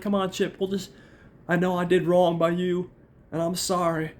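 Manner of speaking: high-pitched, put-on pleading; imitated pleading woman. Monica apologizes to Chip, high pleading imitation. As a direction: wheedle in a mock female voice, up high.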